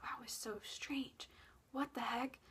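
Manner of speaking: soft uneasy whisper; whispered unease. Retold or imitated. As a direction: breathe it out, uneasy.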